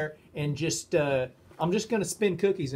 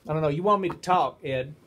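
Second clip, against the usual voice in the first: ordinary talk, nothing marked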